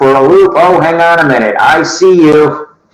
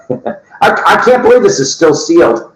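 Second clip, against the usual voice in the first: usual speaking voice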